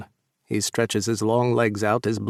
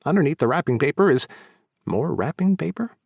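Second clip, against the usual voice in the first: usual speaking voice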